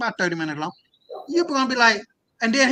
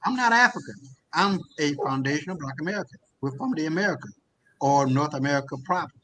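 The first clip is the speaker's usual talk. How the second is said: calm reply; 4 semitones lower, 5 dB quieter than usual. Retold or imitated. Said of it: "calmly said".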